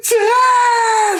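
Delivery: high, hammed-up sobbing scream; crying yell. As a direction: wail it out like a melodramatic movie heroine. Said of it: "like, yelling his name. She's crying like he's dead".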